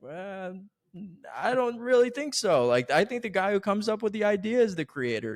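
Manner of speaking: high, loud, drawn-in impression of a dodging interviewee; Stan Lee. Quoting Stan Lee dodging the co-creator question, high mock protest. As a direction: play him high and loud, brushing off the question. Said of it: "the way he talks about it".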